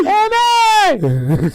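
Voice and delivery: high, loud, drawn-out mock holler; calling out. Retelling a movie scene to friends, hammed-up high shout. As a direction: holler the name like the movie character. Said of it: "He said".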